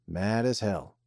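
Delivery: usual speaking voice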